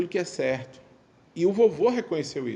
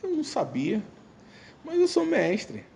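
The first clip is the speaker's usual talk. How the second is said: moved reply; 11 semitones higher, slower than usual. Retold or imitated. Grandson answers grandpa, moved.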